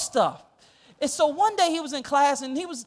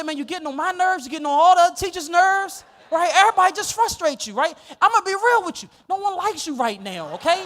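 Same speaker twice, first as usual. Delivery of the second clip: put-on louder, firm teacher telling off a kid; stern scolding; 4 semitones higher, 7 dB louder than usual. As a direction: lay it on the line to the troubled kid. Teacher scolding student outside class.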